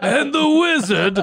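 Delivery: high, slow, exaggerated old-man narrator; hammed-up ancient storyteller, drawn out; older old man. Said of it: "make it older".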